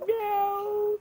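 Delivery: high meow. Shown as a demonstration. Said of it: "how does the kitty cat go?".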